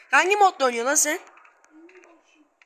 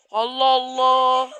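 drawn out, louder; slow exasperated exclamation; 5 dB louder, much slower than usual. Real, not acted. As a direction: stretch it in disbelief.

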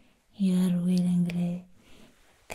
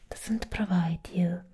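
ordinary talk, nothing marked

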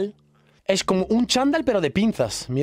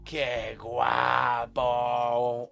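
amazed drawn-out exclaim; 7 semitones lower, 4 dB quieter, much slower than usual. Real, not acted. Admiring the jumper to viewers, long and slow in awe. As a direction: stretch it out slowly, lower, in awe.

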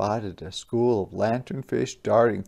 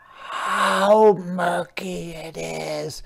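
complaining; 6 semitones higher, 4 dB louder, much slower than usual. Acted. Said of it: "complained the coral".

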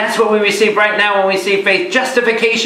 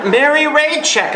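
put-on high, slow old-lady announcement; old lady announcing; 9 semitones higher, slower than usual. An old woman at a wake announcing the name with relish.

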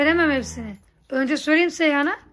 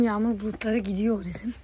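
whisper; 6 semitones lower, 5 dB quieter than usual. Real, not acted. Whispered complaint.

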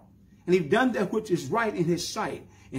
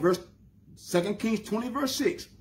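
ordinary talk, nothing marked

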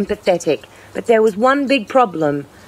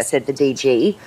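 plain reading voice

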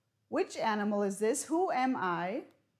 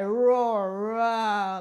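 loud, drawn-out mock roar; teacher's playful big-cat roar; demonstrated lion roar; 6 dB louder than usual. Shown as a demonstration. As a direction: roar the word out like a lion, loudly. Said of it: "like that".

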